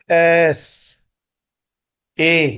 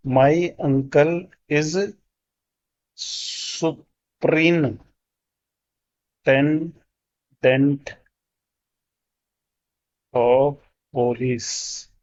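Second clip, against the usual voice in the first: slow dictation; much slower than usual. Shown as a demonstration. Teacher reading the sentence slowly for the class. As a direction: stretch superintendent out very slowly. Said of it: "इस तरीके से देखता हूँ".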